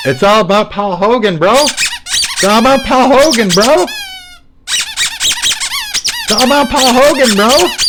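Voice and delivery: very high, loud, drawn-out yelling; escalating shout. Acted. Hyping Paul Hogan to a bro, in an escalating high shout.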